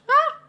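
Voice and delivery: startled scream. Real, not acted. Near death in game.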